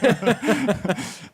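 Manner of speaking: high, bright burst of real laughter. Real, not acted. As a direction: laugh out loud at the joke. Laughs at accent joke.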